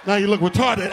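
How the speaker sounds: ordinary talk, nothing marked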